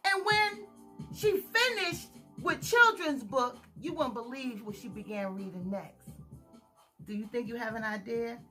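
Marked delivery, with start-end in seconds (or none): none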